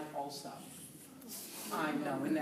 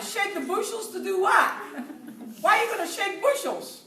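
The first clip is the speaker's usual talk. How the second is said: high, loud mock disbelief; baffled; 11 semitones higher, 4 dB louder than usual. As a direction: question an odd saying with comic bewilderment. Acts out bafflement at American sayings.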